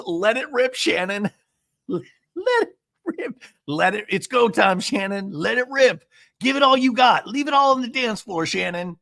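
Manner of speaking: high mock cheerleading; Jen Pedranti. Recapper mimics a friend urging her on, high mock encouragement. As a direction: egg her on in a high, hammed-up voice. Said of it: "And then Jen goes".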